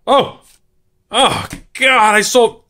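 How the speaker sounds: high, loud oh God; stung by remembering he sold it; dismayed shock. Real, not acted. Realizes he sold the card.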